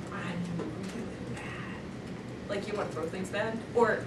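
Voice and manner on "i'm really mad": very soft, slow seething; soft, drawn-out suppressed fury; quietly fuming. Acted. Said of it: "And you're like".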